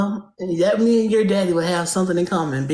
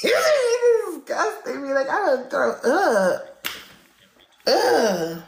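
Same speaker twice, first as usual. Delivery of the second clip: laughing disgust; 6 semitones higher, much slower than usual. Real, not acted. Mocking disgust on live battle.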